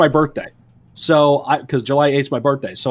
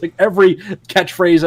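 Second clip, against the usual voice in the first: usual speaking voice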